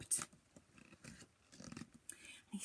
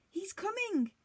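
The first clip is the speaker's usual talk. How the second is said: urgent warning; 9 semitones higher than usual. A narrator's reading. Giantess warns Jack.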